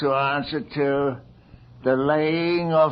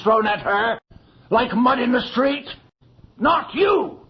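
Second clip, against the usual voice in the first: angry rebuke; 6 semitones higher than usual. Acted. Magistrate silences the girl's complaint with a sharp rebuke. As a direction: cut her off sharply.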